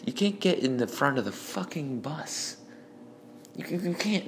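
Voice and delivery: a hint of an irate driver; imitating the bus driver. Bus driver argument voiced.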